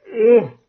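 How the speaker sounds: loud groan of pain. Real, not acted. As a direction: groan out loud from a sore jaw. Jaw hurts from reading.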